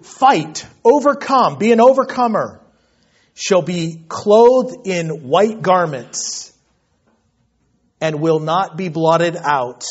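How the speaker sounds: emphatic declaiming with weighty pauses; forceful scripture preaching. Real, not acted. Preacher urges congregation to be overcomers, forceful. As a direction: drive each phrase home like a rallying cry.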